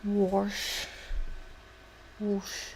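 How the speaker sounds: "Wash" with an R before the sh; regional "warsh" pronunciation. Shown as a demonstration. Guessing where a word comes from, intrusive-R pronunciation. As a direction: try "Wash" as "warsh", like Pennsylvania. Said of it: "I'm guessing Pennsylvania".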